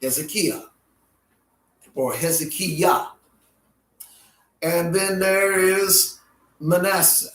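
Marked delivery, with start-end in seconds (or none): 0.0-0.6 Hezekiah as hez-eh-KEE-uh; original-style pronunciation. Shown as a demonstration. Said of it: "commonly called". 2.1-3.1 Hezekiah ending in kee-YAH; alternate pronunciation. Shown as a demonstration. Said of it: "commonly called".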